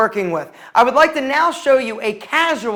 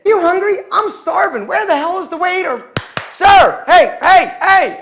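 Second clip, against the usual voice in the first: rude waiter call; 6 semitones higher than usual. Shown as a demonstration. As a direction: bark at the waiter like a slob. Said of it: "I was being rude".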